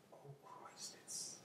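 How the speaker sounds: barely audible, whispered dismay; hushed dread. Acted. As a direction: whisper it as the danger dawns. Realizes fairies are approaching.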